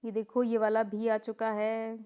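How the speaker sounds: ordinary talk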